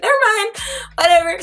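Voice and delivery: high quick never mind; dismissive. Retold or imitated. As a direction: brush the police off. Sister found after all.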